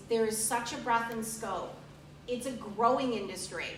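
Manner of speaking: ordinary talk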